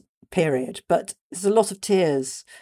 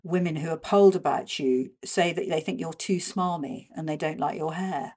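ordinary talk

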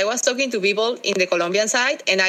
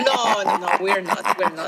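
laughing denial. Real, not acted. Colombian answering a stubbornness jibe, laughing denial. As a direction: protest through real laughter.

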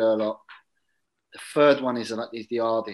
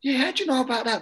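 imitated surprised hero; 10 semitones higher, faster than usual. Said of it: "He was like".

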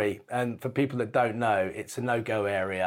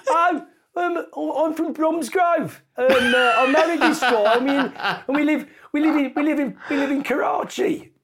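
high Bromsgrove woman; 6 dB louder than usual. Retold or imitated. The woman from Bromsgrove tells the actor about herself, high and gushing. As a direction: gush it out like a delighted Brummie expat. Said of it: "She said".

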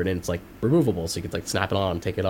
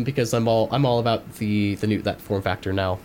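ordinary delivery

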